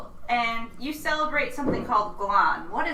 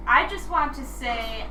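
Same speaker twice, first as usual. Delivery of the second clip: ordinary talk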